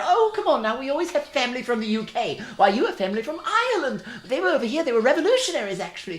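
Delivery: put-on high, hurried English-accented protest; mock English accent, rattling on. [name] defends having U K family, put-on English accent. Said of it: "do an accent".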